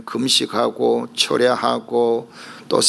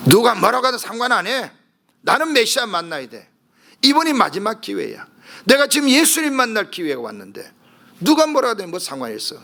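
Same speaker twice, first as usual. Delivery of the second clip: higher, urgent resolve; determined inner voice; 8 semitones higher than usual. Acted. Preacher voices the blind man's desperate resolve, higher and urgent. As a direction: push it out like a last chance.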